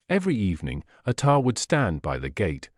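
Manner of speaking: ordinary delivery, nothing marked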